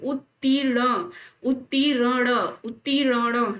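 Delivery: उत्तीर्ण split as ut-tee-ra-na; careful correct pronunciation. Shown as a demonstration. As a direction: drill उत्तीर्ण with the r after tee. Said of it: "र बाद में लग रहा है". (उत्तीर्ण तोड़कर, र बाद में)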